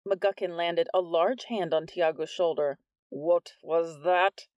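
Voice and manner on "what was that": slow, louder threat; lightly voiced stern demand; menacing principal. A narrator's reading. Vice principal voice.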